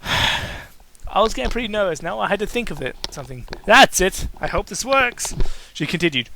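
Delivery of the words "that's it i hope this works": excited. Acted. Character's sudden idea.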